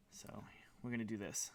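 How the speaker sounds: unvoiced whisper to self. Real, not acted. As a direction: whisper it under your breath. Whispered aside to himself.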